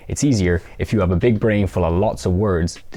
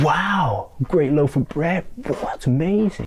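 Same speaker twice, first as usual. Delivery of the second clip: much higher, slow, hammed-up fake wow; overdone enthusiasm; 8 semitones higher, slower than usual. Shown as a demonstration. Teacher mocking an overacted reaction to bread. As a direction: gush insincerely, way over the top. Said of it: "don't overdo this".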